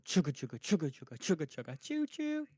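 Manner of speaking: fast chugga chugga train noise; chugging toy train voice. Retold or imitated. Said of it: "Does it go".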